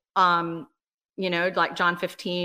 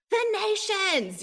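cried out from the spirit; 12 semitones higher, slower than usual. Retold or imitated. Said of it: "out of my spirit came this".

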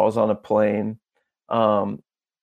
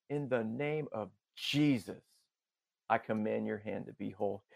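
very soft, intimate proclamation; hushed healing command; 15 dB quieter than usual. Retold or imitated. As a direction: drop almost to a whisper. Repeats the healing command softly.

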